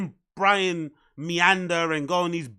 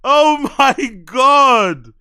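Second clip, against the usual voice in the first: exasperated; 6 semitones higher, 10 dB louder, much slower than usual. Real, not acted. Exasperated reaction to guest.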